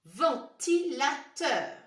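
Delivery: "Ventilateur" slow and loud, each syllable apart; syllable-by-syllable reading. Shown as a demonstration. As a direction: punch out "Ventilateur" as "ven-ti-la-teur". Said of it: "Essayez bien de lire les mots".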